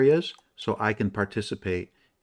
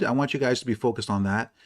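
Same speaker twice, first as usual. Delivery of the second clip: normal talking voice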